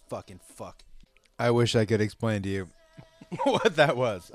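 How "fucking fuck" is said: muttered angry curse. Real, not acted. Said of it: "I'm gonna lose my mind".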